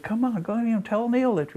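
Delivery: high, wheedling mock plea; imitated pleading guy. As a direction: beg in a mocking high voice. Fired guy begs him, retold.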